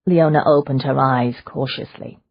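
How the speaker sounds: plain reading voice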